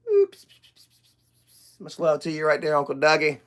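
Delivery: usual speaking voice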